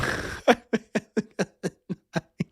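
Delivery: long stuttering laugh. Real, not acted. Streamer cracking up at own quiz blunder, laughing. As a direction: laugh it out in a drawn-out run.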